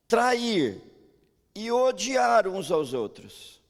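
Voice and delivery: fiery slow sermon emphasis; preaching at full force. Acted. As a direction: hammer home the warning, slowly and loudly. Preacher's warning climax.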